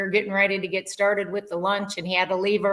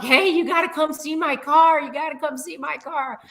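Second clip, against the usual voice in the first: excited friend; 8 semitones higher than usual. Retold or imitated. Imitating her friend showing off his new Lexus, excited. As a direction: mimic a proud new car owner calling you over. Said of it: "he was like".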